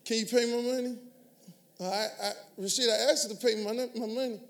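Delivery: soft, hesitant request for money; timid asking. Shown as a demonstration. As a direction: ask meekly, almost apologetic. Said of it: "No, that's not a decree".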